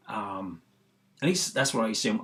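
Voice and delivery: ordinary delivery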